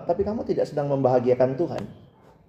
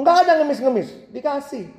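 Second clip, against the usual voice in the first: much higher retort breaking into laughter; laughing; 9 semitones higher than usual. Real, not acted. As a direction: crack up at your own comeback. Preacher retort then laughs. (jawaban tinggi lalu tertawa terbahak)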